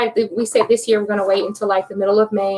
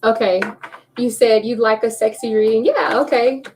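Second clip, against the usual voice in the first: ordinary talk, nothing marked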